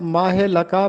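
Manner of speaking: माहलका as "maa-he-la-kaa"; wrong linked माहलका, "maahe-lakaa"; common mispronunciation. Shown as a demonstration. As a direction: add an e after maah in माहलका. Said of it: "पढ़ते हैं".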